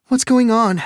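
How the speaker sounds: very high, loud alarmed question; frightened mother's demand. Acted.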